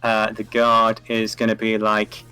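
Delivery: ordinary talk, nothing marked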